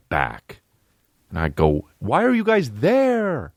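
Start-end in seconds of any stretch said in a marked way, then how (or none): 2.0-3.5 very high, drawn-out comic question; stretched falsetto protest; high incredulous whine. Retold or imitated. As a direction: squeal it in mock disbelief, slowly. Retold yell at tiny crew.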